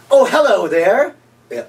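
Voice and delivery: cheesy infomercial host. Acted. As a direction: greet the viewer like a late-night TV huckster. Retake of infomercial greeting.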